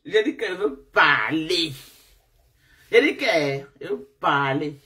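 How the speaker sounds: normal talking voice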